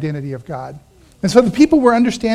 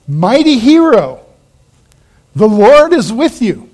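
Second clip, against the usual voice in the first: loud, raised angelic greeting; grand proclamation; 3 semitones higher, 6 dB louder than usual. Acted. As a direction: proclaim it like a herald from heaven. Angel's proclamation read aloud.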